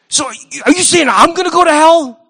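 high, loud mock-innocent question; mock interviewer. Preacher mocking a TV host's gotcha question with a high voice. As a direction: put on a pointed, fake-naive voice.

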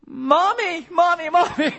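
put-on the boy gives up on dad and cries for mom; child calling mommy. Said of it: "Then they go".

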